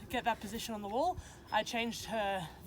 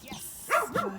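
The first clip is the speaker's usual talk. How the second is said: much higher, louder bursts of praise; excited praise; 5 semitones higher, 8 dB louder, much slower than usual. Real, not acted. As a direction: cheer the dog on, high and loud.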